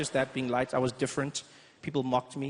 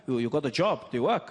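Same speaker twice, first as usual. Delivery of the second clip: put-on German accent; 2 semitones lower than usual. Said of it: "he goes".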